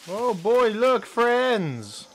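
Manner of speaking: high, loud excited exclamation. Acted. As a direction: call out gleefully to friends. Excited call to viewers.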